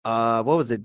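ordinary delivery

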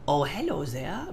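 lightly imitated Australian-Scottish greeting; soft, lightly put-on Australian-Scottish lilt; mock Australian-Scottish accent. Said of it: "Australian, Scottishy kind of accent".

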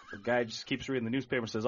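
usual speaking voice

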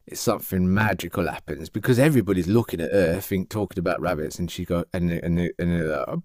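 ordinary talk

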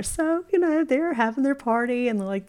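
ordinary delivery

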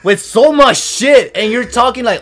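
loud, high, slow word-by-word rant; indignant scolding. Acted. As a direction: hammer each word in exasperation. Scolding privileged kids who complain.

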